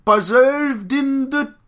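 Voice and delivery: loud, slow, high-pitched Cockney caricature; Cockney accent. Acted. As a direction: drag out each word in garbled Cockney. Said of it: "I like your Cockney accent".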